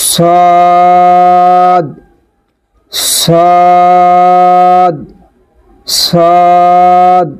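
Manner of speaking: সদ with the vowel held long; heavy, sustained সদ; drawn-out sad. Shown as a demonstration. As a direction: stretch সদ for four counts. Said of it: "চার আলিফ পরিমাণ টেনে পড়তে হবে".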